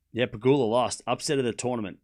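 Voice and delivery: usual speaking voice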